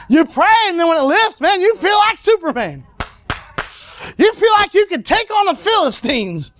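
high, ringing preacher's exhortation; rousing preaching. Real, not acted. Preacher telling the congregation how relief feels, rousing preaching. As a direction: lift the congregation with each phrase.

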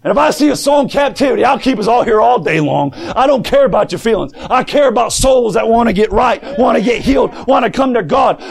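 full-force preaching. Acted. Preacher rallying the church against unbelief, shouting. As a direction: preach it hard to the congregation.

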